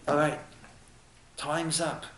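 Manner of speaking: softly voiced heavenly judge; quiet verdict. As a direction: break it to them softly. Heavenly court announcing the end.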